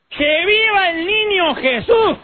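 put-on high, slow drawn-out drunken cheer; hammed-up tipsy shout, far above normal pitch; church drunk. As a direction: holler like a drunk from the back of church. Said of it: "se paraba en el fondo".

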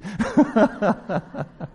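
loud high laugh. Real, not acted. Speaker laughing after the joke, loud laugh. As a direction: burst out laughing.

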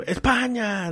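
a hint of a stadium chant in a strange accent; mock foreign fan cry. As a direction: tourist fan shouting his team's name. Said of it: "alguien grita con un acento medio raro".

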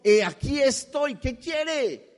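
high, slow exclaimed answer; God answering brightly at once; high eager voice of the Lord. Pretend. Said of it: "entre signos de admiración".

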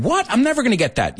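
high, whiny student voice; mock student complaint. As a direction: playfully whine. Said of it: "those of you who said".

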